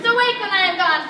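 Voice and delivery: loud command. Acted. Puck ends the charm.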